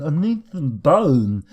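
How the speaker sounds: mock the moaning dwarves; whiny dwarf. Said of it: "people have sat there like lemons going".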